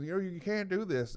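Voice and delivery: high-pitched mock plea; imitating pleading family. Family clinging to the man who leaves, pleading. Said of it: "they're grabbing hold of him".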